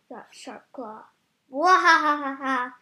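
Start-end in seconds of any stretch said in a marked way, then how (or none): 1.5-2.7 slow, loud evil cackle; mad scientist laugh. Acted. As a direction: cackle like a gleeful villain. Villain cat gloats over plan.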